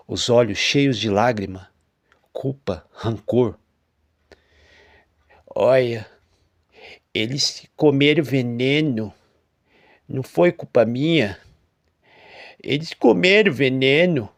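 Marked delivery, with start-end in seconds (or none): 5.5-14.3 defensive farmer. A narrator's reading.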